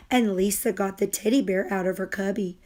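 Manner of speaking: plain reading voice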